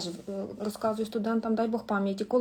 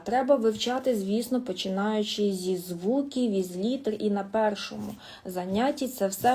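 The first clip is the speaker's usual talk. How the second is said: ordinary talk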